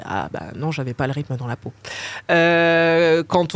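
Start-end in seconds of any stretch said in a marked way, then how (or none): 0.0-1.7 softer, lower quoted reply; understated retort. Retold or imitated. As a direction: drop the voice for a quick, quiet aside. Retells own reply to the teacher.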